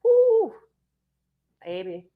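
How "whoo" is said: a sharp high whoop of disbelief; high whoo. Real, not acted. Whooping to viewers while still fired up about the draft. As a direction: let out a high exasperated whoo.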